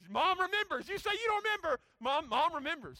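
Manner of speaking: high, loud, playful protest; loud insistence. Real, not acted. Pastor calling on Daniel's mom as witness, loud insistence. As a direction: call it out high and loud, overruling him. Teasing Daniel over his denied memory.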